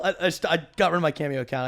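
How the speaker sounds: ordinary talk, nothing marked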